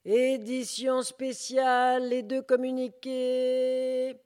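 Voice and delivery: high, loud, slowly drawn-out street cry; newspaper crier. Acted. Newspaper seller crying out to passers-by, long and loud. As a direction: hawk the special edition to the whole street. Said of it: "Deuxième crieur de journaux".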